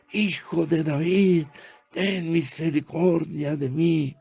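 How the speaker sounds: high, loud pleading cry, drawn out; desperate shout. Retold or imitated. Blind beggar crying out over the crowd to Yeshua, desperate high shout. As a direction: call out to him over the crowd with all you have. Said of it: "Y él gritaba mucho más".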